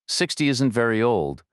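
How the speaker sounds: usual speaking voice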